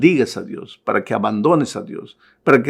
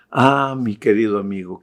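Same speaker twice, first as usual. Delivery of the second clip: ordinary talk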